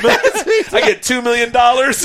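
high, loud, slow, laughing celebration; mock gleeful widow. Wife celebrating the payout when he dies, gleeful. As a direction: pretend to rejoice through laughter. Imagining wife rejoicing at his death.

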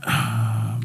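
long real exhale; heavy sigh. Real, not acted. Remembering that emotional night, heavy sigh. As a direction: sigh before going on.

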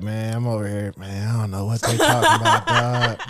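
low, laid-back smooth mumble; Tizzy. Retold or imitated. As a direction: play it low and unbothered. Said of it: "That's what he sound like".

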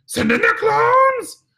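slow, very high, loud screech; mock shrieked villain's order, drawn out; Frau Farbissina. Imitating the Austin Powers villain, a screamed command. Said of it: "Frau Farbison, and she would".

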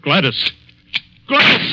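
calling her name, rising to a loud high cry; alarmed shout. Acted. The man yells for Gladys as she threatens him, loud.

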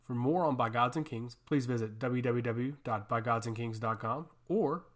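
quick announcer outro read; podcast outro. Acted. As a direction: rattle off the outro.

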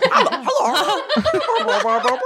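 high slow laughing hello; hammed-up sweet Canadian babble; silly Canadian girl. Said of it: "still with her accent".

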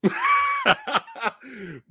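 high bursting laugh of disbelief; exasperated laugh. Real, not acted. Disappointed by the rating, an exasperated high laugh.

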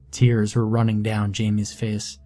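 ordinary delivery

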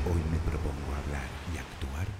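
distant, very soft repeat of an affirmation; fading echo. Acted. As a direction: let each repeat recede further away.

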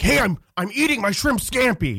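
high, lightly voiced snooty yell; a hint of an annoyed celebrity; mock yell. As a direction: brush them off like a big shot. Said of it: "he'd yell".

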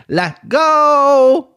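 high, loud rallying call; hype shout. Real, not acted. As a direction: shout it out to pump up the listeners. Hyping listeners to join.

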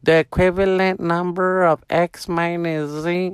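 high, slow droning lecture voice; mock teacher heard from a laptop; teacher impression. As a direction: drone it like an online class teacher. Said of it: "just hear the teacher".

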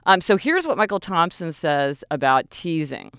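ordinary talk

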